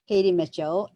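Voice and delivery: usual speaking voice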